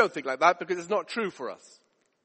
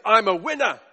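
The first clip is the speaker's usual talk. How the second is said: full-voiced, confident boast; cocky man showing off; boastful winner; 4 dB louder than usual. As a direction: proclaim it like a self-made champion. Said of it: "That's how he spoke".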